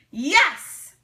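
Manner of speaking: answering yes. A narrator's reading. Small chorus answering.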